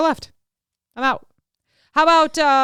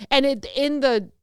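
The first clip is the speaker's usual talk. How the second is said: usual speaking voice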